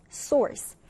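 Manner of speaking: source with an R-coloured vowel. Shown as a demonstration. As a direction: sound the R in source. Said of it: "R 발음이 또 들어갑니다". (R 발음 넣어 source)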